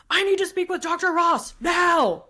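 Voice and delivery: loud, high angry demand, slowly; an upset husband yelling to see the doctor. Retold or imitated. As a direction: bark it at the front desk. Angry husband at front desk.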